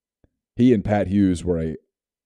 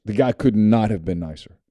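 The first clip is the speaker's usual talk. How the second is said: normal talking voice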